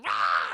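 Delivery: deep loud mock roar; low monster roar. Possessed wife bites.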